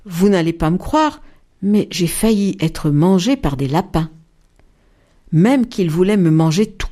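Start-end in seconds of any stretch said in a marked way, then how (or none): none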